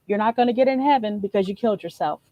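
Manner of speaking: normal talking voice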